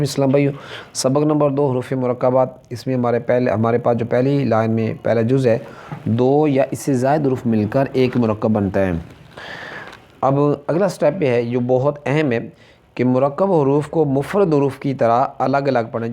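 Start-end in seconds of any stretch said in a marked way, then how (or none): none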